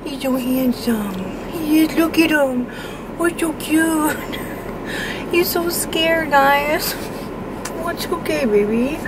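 high, drawn-out cooing; doting pet talk. Real, not acted. Owner admiring her scared, freshly groomed dog, doting pet talk.

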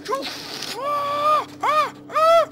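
loud high terrified screams; real panicked shrieks of no and let me go; terrified screaming. Real, not acted. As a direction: scream for your life as it grabs you. Monster grabs player.

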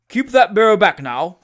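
loud called-out order; crying out. Acted. Host ordering the barrow held back, crying out. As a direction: call it across the field. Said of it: "cried Wardle".

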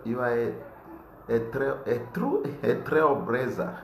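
usual speaking voice

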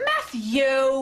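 snapping a name in reproach; sharp rebuke. Real, not acted. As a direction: cut him off with his name. Scolding partner mid-scene.

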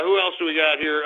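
normal talking voice

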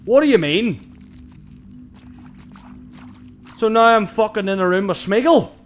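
high loud panicked voice; scared horror game streamer. Real, not acted. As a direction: panic at the creepy room.

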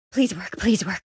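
whispered, hurried hope; hushed desperate wishing; pleading whisper. Acted. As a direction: plead under your breath. Hoping desperately under the breath.

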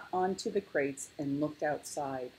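ordinary delivery

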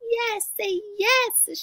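high, drawn-out urging; excited cub shout. A narrator's reading. Cubs urging Mama to agree, high excited shout. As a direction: urge like clamoring children. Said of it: "shouted the cubs".

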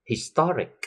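"historic" with a high punch on TOR; stress on TOR. Shown as a demonstration. Teacher drilling -ic words, TOR stressed in "historic". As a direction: hit the middle of "historic", his-TOR-ic. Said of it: "sẽ được nhấn âm ngay phía trước".

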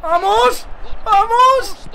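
high screams, words stretched out long; real goal celebration. Real, not acted. Player celebrates scoring against Barça, triumphant screaming. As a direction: yell in triumph, drawing out each word.

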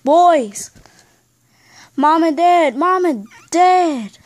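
loud play-acted homecoming call. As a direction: call through the house, stretching the last word. Parents return, family calls out greetings.

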